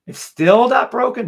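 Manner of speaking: high, loud burst of amazement; amazed outburst. Real, not acted. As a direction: exclaim it in high-pitched, loud disbelief. Amazed that block holds on chart.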